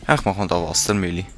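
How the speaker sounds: normal talking voice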